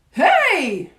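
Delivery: high, loud startled shout. A narrator's reading. Startled cook feels a mouse on him, shouting. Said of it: "shouted the cook".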